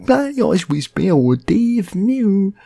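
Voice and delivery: loud, high, drawn-out mumble; mumbling bloke. Retold or imitated. Mate answering Barry, loud and garbled. Mate's mangled bloke speech.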